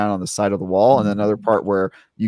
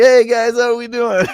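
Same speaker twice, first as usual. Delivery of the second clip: high, loud, hammy hello to the crowd; loud cheery greeting; 7 dB louder than usual. Retold or imitated. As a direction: call out a big goofy hello. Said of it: "I was like".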